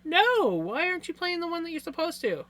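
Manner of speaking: normal talking voice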